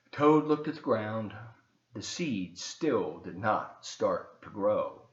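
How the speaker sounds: put-on slow, gentle read-aloud for children; story narration. A narrator's reading. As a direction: tell the story slowly to the kids. Reads Frog and Toad aloud.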